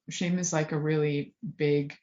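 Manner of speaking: ordinary delivery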